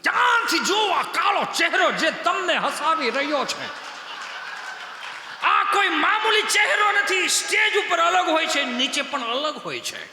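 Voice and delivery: hammed-up town crier warning the crowd; mock announcer. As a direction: bellow it like a fairground crier, drawn out. Mock proclamation about the dark face.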